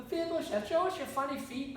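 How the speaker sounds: put-on kids teasing the lame boy in a chant; mocking children.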